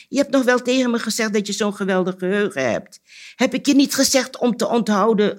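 high, heated telling-off; angry scolding. A narrator's reading. Anansi berates the cricket for forgetting, angry scolding.

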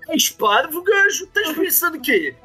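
put-on high, indignant European Portuguese accent. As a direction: scold like an annoyed Lisbon game master. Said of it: "tinham sotaque português?".

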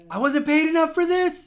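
high, loud panicked yelp; goblin scream. Acted. Fleeing goblin yelling as it runs, high panicked yelp. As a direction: shriek it as a fleeing coward.

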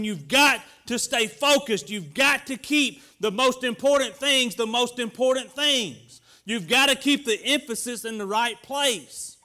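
loud, driving sermon cadence; preaching at full force. Acted. Pastor urging the church to stay focused, preaching hard. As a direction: press the congregation to stay in the fight. Preaching about not quitting.

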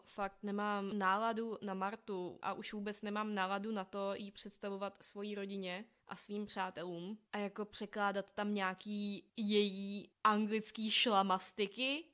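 ordinary talk

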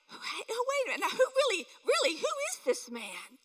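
high, hushed amazement; awed disbelief. Retold or imitated. Disciples asking who Jesus is after the calm sea, awed. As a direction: gasp it out, stunned. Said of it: "they're like".

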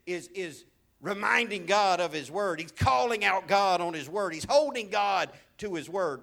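loud, mock preacher boast; mocking other preachers. Pastor mimicking other preachers, in a loud mock boast. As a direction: imitate a swaggering preacher. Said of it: "some preachers would say".

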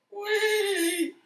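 high, drawn-out cry; imitated squeal from a cramped rider; mock squeal. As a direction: stretch it into a high comic squeal. Acting out the moment on the ride.

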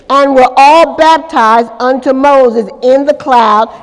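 loud, emphatic Bible reading; scripture reading. Acted. Preacher reads the verse to the congregation, loud and proclaiming. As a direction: proclaim the verse, leaning on key words.

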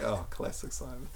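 breathless slow whisper; fondly mocking under the breath; whispered aside. About Simon's rules blunder, whispered.

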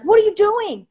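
high, loud, exasperated yell; yelling at her. Retold or imitated. Gamer to coworker playing terribly, yelling. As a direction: imitate yourself yelling at a hopeless teammate. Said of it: "I'm yelling at her".

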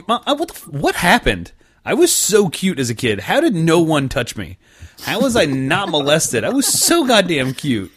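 high, loud, indignant whine at his mom; mock outraged teen. Teen at Olive Garden loudly whining to his mom, hammed up. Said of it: "I was like".

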